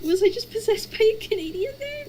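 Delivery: stunned. Retold or imitated. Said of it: "and I was like".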